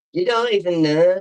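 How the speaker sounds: playful mimicry of her own line; mocking echo. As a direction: throw the words back teasingly.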